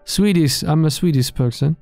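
ordinary talk, nothing marked

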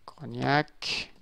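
understated, quiet naming; subdued. Real, not acted. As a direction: read the name softly to yourself. First reading of the poster name.

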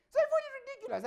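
high, loud incredulous burst. Real, not acted. Host recalling the silly accusation, high and loud. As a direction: blurt it out in disbelief.

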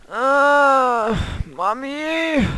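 high mock-scared cry for mommy; mock fright. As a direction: squeal like a frightened child. Scared in dark cave.

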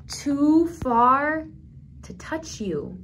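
slow story line fading to a hush on Tatita; storybook reading, grief gently; tender sad reading. Acted. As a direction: let the sadness settle, trail off.

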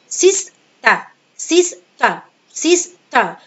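سيستا said slowly in two beats, no final r; sister split in two. Shown as a demonstration. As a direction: break سيستا into two clear syllables, slowly. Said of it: "وانا بنطقها بقسمها نصين".

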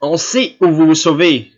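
a knowing, loaded jab; grave. A narrator's reading. Wife needling her husband about his excuse, gravely loud. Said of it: "dit gravement la générale".

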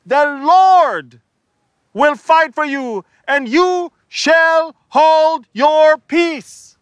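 high, loud, last words very slow; shouting at the people. Acted. Moses yelling at the murmuring crowd, loud. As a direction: bellow it at the crowd. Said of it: "He's talking to the people".

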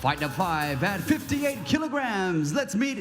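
high, very slow, stretched-out announcer calls; ring announcer. Acted. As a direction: drag out every word for the crowd. English ring introduction, drawn out.

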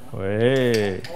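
ordinary delivery

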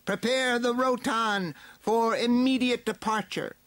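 very high, piercing villain order; villain's shouted command. A narrator's reading. Skeletor orders his slaves to get ready, very high shout. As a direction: call it out high as an evil overlord. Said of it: "Skeletor shouted to his slaves".

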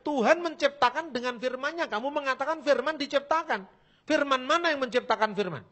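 loud, high, scolding shout; angry shouting. Retold or imitated. Preacher shouting at the Jehovah's Witness in debate, furious. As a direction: shout him down with anger. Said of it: "Saya bentak orangnya".

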